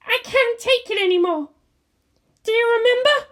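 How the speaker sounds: high haunting voice; eerie taunt. Acted. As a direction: needle her with memories. Angel taunts.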